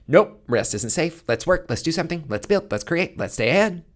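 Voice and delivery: lightly voiced panicked nervous system, hurrying; frantic nervous system. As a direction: play the body refusing to rest, pushing to work. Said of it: "your system went".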